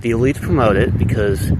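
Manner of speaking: ordinary delivery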